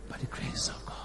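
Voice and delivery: a hushed, breathy-free whisper. Acted. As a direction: drop to a whisper on the grace of God. Quoting Paul, hushed.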